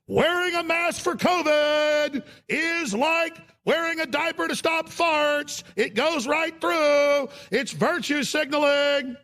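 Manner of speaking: high, drawn-out bellow; mad on-air rant. Shown as a demonstration. Host showing how he used to rant on air, mad bellow. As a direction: stretch every word into a high, sustained yell. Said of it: "I used to get mad on there".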